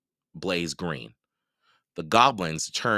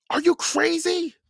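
much higher, louder yell; 7 dB louder than usual. A narrator's reading. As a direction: shout at him in disbelief. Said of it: "Mallory yelled".

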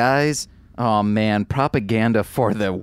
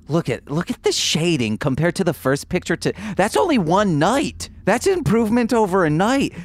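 excited; 6 semitones higher than usual. Acted. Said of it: "I'm like".

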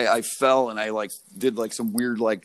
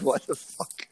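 dazed whisper; 5 dB quieter, slower than usual. Retold or imitated. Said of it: "I remember being like".

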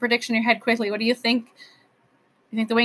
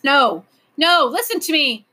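raised, insistent plea; pleading; 5 semitones higher, 6 dB louder, slower than usual. A narrator's reading. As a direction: beg to be heard over objections. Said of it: "the professor pleaded".

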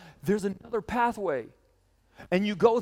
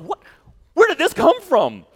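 louder, higher startled cry; mock startled disbelief; surprised shock; 8 semitones higher, 9 dB louder than usual. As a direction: blurt it out like you just found cash. Finding cash in pocket.

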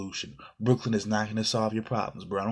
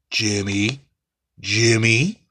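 louder, pointed call of the name, rising; 10 dB louder, much slower than usual. Real, not acted. Host calling the player out by name, loud and rising.

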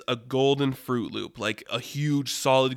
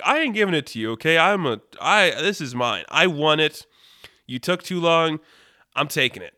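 higher, insistent mock indignation; stubborn, raised-pitch claim of ownership; defiant refusal; 4 semitones higher, 4 dB louder than usual. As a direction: dig in and refuse, acting it out. Acted refusal to hand over loop.

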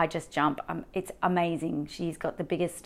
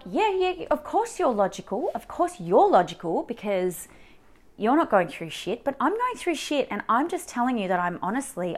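high, loud, exasperated comeback; indignant retort; 5 semitones higher, 5 dB louder than usual. Retold or imitated. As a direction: throw it back at the therapist, pitched up and exasperated. Acted indignant retort to the therapist.

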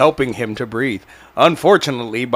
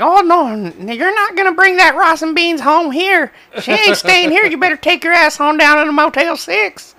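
high, hammed-up angry mom; shrill-free mock Southern mom rant; 3 dB louder than usual. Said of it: "she was like".

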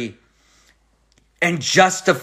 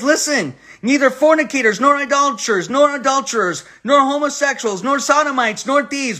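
high, ringing pulpit reading of the list; preaching scripture; 9 semitones higher than usual. Acted. Preacher reading Paul's list to the congregation, ringing and raised. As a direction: proclaim each nor like a verdict from the pulpit. Reads scripture at full force.

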